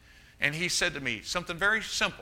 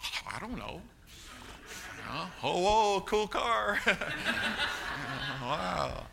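put-on daydreaming teenager; 2 semitones higher than usual. Preacher imitating his teenage self daydreaming while driving, goofy and giggling. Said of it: "What, what were you thinking about?".

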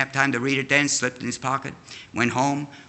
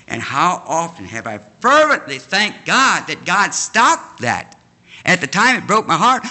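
raised, louder pulpit voice; fervent preaching; 6 semitones higher, 4 dB louder than usual. Real, not acted. Preacher thanking God to the congregation, fervently. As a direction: preach it higher and emphatic, hammering key words.

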